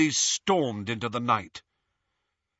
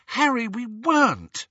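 put-on higher girl's voice, pleading denial; Hermione protesting; 12 semitones higher, 3 dB louder than usual. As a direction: protest it in a high, anxious voice.

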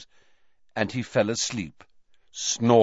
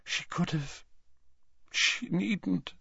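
high, soft, halting dismay; hushed horror; 10 semitones higher, 6 dB quieter than usual. A narrator's reading. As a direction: falter through it, appalled. Slughorn appalled by the account.

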